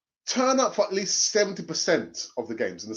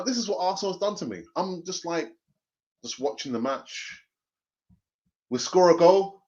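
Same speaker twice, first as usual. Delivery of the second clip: ordinary talk, nothing marked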